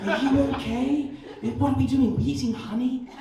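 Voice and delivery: high-pitched, gently probing interviewer impression; Louis Theroux. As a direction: question wild bears like a careful documentary maker. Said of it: "Louis Theroux interviewing a family of grizzly bears".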